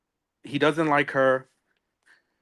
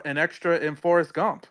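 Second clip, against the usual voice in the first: ordinary talk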